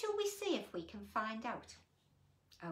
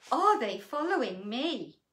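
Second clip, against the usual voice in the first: mock scared; 3 semitones higher, 12 dB louder than usual. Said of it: "do you think to yourself".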